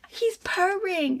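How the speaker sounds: put-on high, drawn-out child's voice; little girl. Little girl proudly showing off the cat, imitated high and slow. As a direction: imitate a delighted child, stretching the words.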